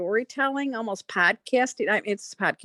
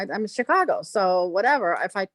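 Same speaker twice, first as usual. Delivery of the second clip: ordinary talk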